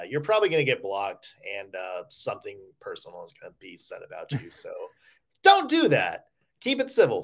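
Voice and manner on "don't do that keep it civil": high-pitched, louder mock warning; mock telling-off, pitched way up; playful scolding. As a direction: scold the fans in a raised, high voice. Playful warning to chirping fans.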